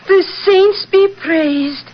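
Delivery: high, slow gasp of holy surprise; high slow "saints be praised"; pious exclamation. Acted. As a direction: cry it out to the heavens. Kathleen hears of the murder.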